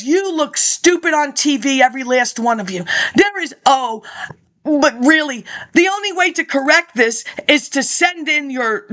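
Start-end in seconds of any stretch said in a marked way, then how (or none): none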